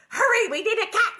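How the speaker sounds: high, loud mock-urgent cry; hammed-up frantic call; urgent shout. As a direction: yell it like a comic emergency. Acting urgent order for a cat.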